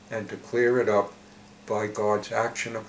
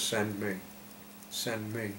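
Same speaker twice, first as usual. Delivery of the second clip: soft, simple answer, fading; quiet offering; 8 dB quieter than usual. Acted. Isaiah answering God, offering himself quietly. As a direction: offer it quietly, then softer still.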